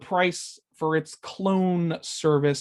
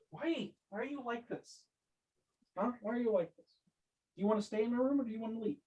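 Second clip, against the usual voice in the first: soft, higher voice talking to the cat; scolding a pet; 4 semitones higher, 10 dB quieter, much faster than usual. Real, not acted. Host asking the cat why it is like this, soft higher-pitched scolding. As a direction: quietly plead off-mic with a misbehaving cat.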